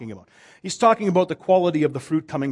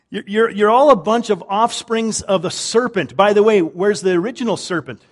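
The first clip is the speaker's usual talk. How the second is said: louder, rising preacher's push; fervent preaching; 6 semitones higher, 8 dB louder than usual. Acted. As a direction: drive it home from the pulpit. Preaching at full force.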